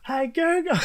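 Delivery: high, strained call to the car; imitating Courtney yelling. Mimicking wife calling to the car assistant, pitched high. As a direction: mimic a driver hollering at the dash. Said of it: "she goes".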